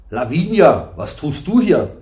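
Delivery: loud, indignant father's demand; cross. A narrator's reading. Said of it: "sagte erbost". (erbost, mit erhobener Stimme)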